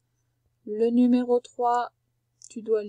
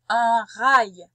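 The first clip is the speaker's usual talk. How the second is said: "Un rail" called out much louder; emphatic dictation; 8 dB louder than usual. Shown as a demonstration. Teacher dictating "Un rail" to a pupil, loud. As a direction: announce "Un rail" loud and clear. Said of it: "J'ai bien dit un".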